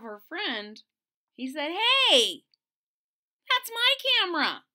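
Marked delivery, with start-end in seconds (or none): 2.1-4.6 put-on high loud boy protest; indignant shout. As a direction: yell it's mine. Martin protest retold.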